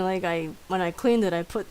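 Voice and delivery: ordinary talk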